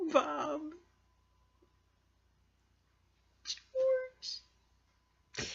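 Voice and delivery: high drawn-out mock wail of grief; high, long mock lament; mock mourning. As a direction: call out to lost pets, hammed-up. Missing dead ladybugs.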